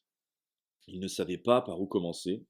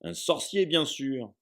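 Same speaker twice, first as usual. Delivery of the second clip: higher, bright matter-of-fact; cheerful; 7 semitones higher, 4 dB louder, slower than usual. Acted. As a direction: announce it as obvious. Hagrid cheerfully stating obvious.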